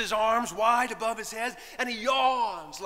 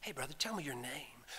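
low, soft, friendly coaxing; hushed, kindly low voice; gentle; 7 semitones lower, 9 dB quieter than usual. Acted. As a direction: invite him quietly and gently. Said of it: "Is that not the most gentle?".

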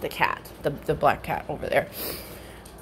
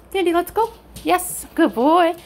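dog praise voice; 12 semitones higher, 5 dB louder than usual. Real, not acted. Trainer praising her dog Tandy, high sing-song praise. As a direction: coo delighted praise at a good dog.

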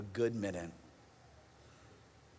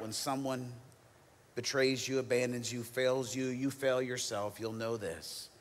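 ordinary delivery

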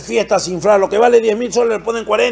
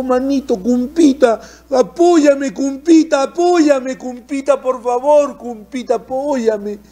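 higher, whining mock plea for support; Cañita's tearful begging; 6 semitones higher than usual. Commentator mocking a candidate begging voters, higher whiny plea. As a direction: imitate a politician sniveling for votes, pitch raised. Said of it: "yendo a llorarle a la gente".

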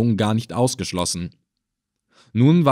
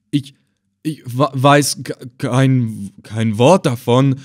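put-on the old man stammers a denial in fright; stammering; slower than usual. A narrator's reading. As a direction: caught out, stumbling over half-words. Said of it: "stammelte er".

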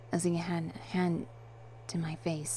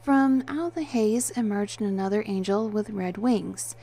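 ordinary delivery, nothing marked

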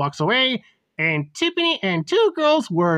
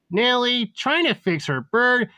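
usual speaking voice

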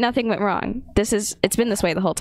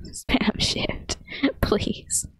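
breathy giggling whisper of "Spam shift"; laughing whisper; 3 semitones higher, 4 dB quieter, much slower than usual. Real, not acted. Repeating chat's tip to a stuck friend, giggling whisper.

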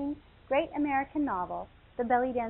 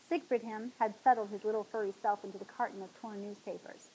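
ordinary delivery, nothing marked

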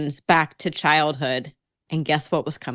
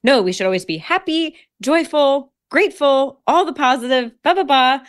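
higher, sing-song mock of forced positivity; mocking old belief; 7 semitones higher than usual. Mocking her old always-be-happy belief, sing-song and high. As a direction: parody the always-be-happy rule lightly. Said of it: "thing ingrained in my brain".

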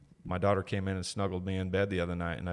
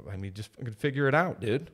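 mock impatient snap, a bit higher and louder; 5 semitones higher, 4 dB louder than usual. Dad telling his son to work it out, impatient. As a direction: act out a mildly exasperated dad.

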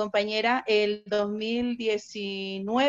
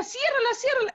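panicked plea; 13 semitones higher than usual. Retold or imitated. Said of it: "y me decía".

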